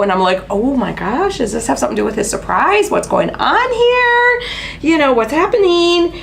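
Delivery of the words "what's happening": normal talking voice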